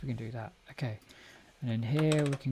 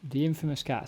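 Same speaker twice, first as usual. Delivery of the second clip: ordinary delivery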